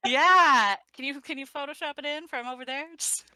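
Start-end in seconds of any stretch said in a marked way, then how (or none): 0.1-0.8 loud happy shout; excited cheer. Real, not acted.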